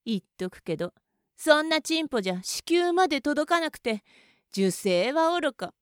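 usual speaking voice

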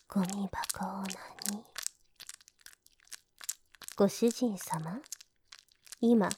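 soft sneering whisper; hushed mockery; scornful whisper. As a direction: breathe the insult out quietly. Maid sneers at master.

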